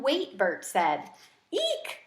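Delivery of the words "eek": very high eek, squeaked; a tiny hamster shriek of alarm; a frightened hamster's high squeak; startled squeak. A narrator's reading. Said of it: "I squeaked".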